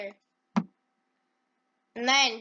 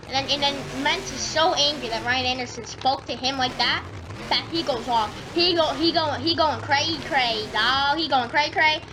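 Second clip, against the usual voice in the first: ordinary talk, nothing marked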